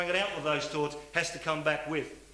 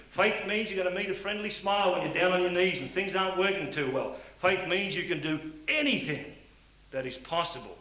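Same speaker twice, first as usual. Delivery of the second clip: raised, higher fervent sermon voice; preaching; 6 semitones higher, 4 dB louder than usual. Acted. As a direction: preach faith to the hall at full force. Preaches about faith.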